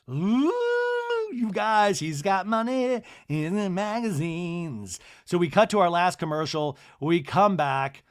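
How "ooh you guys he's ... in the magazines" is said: high, drawn-out swooning ooh; mock gushing. Teasing about the new boyfriend, mock-impressed gushing. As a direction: gush in a stretched, high put-on voice.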